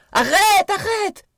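very high, loud pleading cry; desperate shout. A narrator's reading. Miranda screams at Daisy to stop hitting Tom, high desperate cry. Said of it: "Miranda criait vainement".

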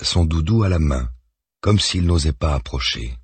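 usual speaking voice

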